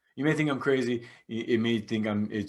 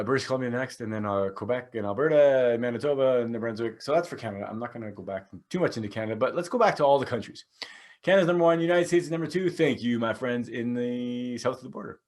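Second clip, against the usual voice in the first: usual speaking voice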